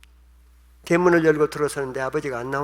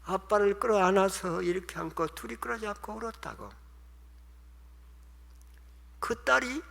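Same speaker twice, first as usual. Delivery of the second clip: usual speaking voice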